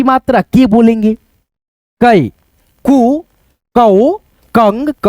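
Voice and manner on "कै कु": normal talking voice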